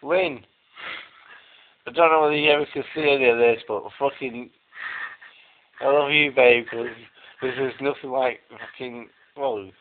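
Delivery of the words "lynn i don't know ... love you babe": louder, rushed love message. Real, not acted. Drunkenly telling girlfriend Lynn he loves her, loud and rushed. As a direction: blurt it to the camera.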